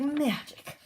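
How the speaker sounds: high, swooping character voice; showy magical exclamation. Acted. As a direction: swoop up high with mock wonder. Character's acted exclamation, repeated higher.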